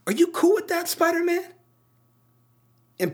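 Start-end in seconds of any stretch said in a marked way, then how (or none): 0.1-1.4 high, squeaky-pitched imitation of a challenge; mock-earnest high-pitched appeal; Miles Morales. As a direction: put the question way up high. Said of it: "he has this moment where he".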